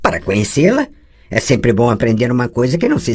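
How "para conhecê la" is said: plain reading voice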